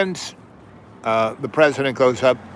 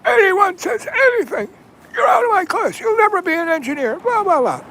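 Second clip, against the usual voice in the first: shrill, much higher whiny mock rant; mocking the teacher; 3 dB louder than usual. Teacher threatening the class as he walks out, in a shrill whiny mock. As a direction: whine out threats like a crybaby. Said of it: "I hear him go".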